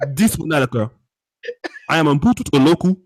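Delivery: stern mock African accent. Co-host warning anonymous callers, stern African accent. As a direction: lay down the law in a put-on African accent. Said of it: "I will use my African voice".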